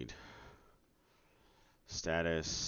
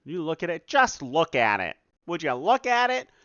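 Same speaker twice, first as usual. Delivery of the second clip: louder and higher, building outrage; exasperated rant; 10 semitones higher, 10 dB louder than usual. Real, not acted. Dev ranting to viewers about the engine, exasperated and louder. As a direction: look at this nonsense. Ranting at broken montage setting.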